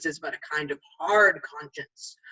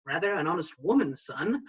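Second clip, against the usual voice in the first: ordinary delivery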